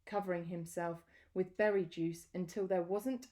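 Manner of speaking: plain reading voice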